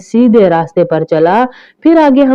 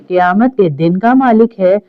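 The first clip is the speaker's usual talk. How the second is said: ordinary delivery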